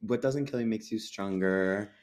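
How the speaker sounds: ordinary talk, nothing marked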